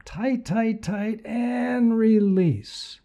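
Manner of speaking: high strained tight tight; right in your ear. Acted. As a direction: squeeze the voice up high on each tight. Coaching muscle squeeze.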